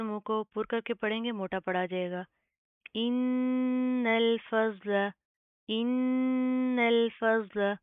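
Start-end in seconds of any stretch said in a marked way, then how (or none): 3.0-7.7 इन्नल with a long nasal hum before the lam, very slow; slow chanted recitation of इन्नल फज़ला; ghunna on nun. Shown as a demonstration. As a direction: hold the doubled nun of इन्नल in the nose. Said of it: "फा और लाम बारीक, ज़ोत मोटा".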